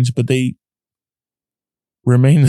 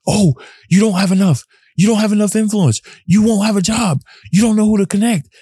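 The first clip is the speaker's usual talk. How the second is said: higher, taunting mind bully voice; negative inner voice; 9 semitones higher than usual. Retold or imitated. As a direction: needle yourself with doubts in a pitched-up voice. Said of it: "wrestling the battle within".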